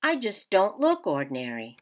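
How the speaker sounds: gentle picture-book read-aloud; reading to children. A narrator's reading. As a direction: read it like story time for kids. Teacher reads picture book aloud.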